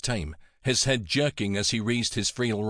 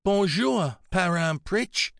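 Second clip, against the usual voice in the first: high, slowed greeting close to tears; voice beginning to crack; 9 semitones higher, slower than usual. A narrator's reading. Melanie greeting her frail godfather, voice beginning to crack. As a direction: greet him with a slight crack in the voice. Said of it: "her voice beginning to crack".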